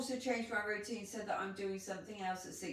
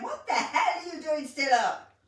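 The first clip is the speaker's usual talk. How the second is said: higher, louder disbelief; incredulous scolding; 5 semitones higher, 5 dB louder than usual. Real, not acted. Co-host to viewer up past midnight, incredulous and louder. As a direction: tell off a night owl in amazement.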